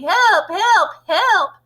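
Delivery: high, loud mock panic; yelled cry for help. A narrator's reading. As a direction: witch mimicking teachers yelling for help. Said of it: "and yelling".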